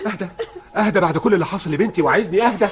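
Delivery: refusing to be calmed; indignant. Acted. Father protesting to the officer that he cannot calm down.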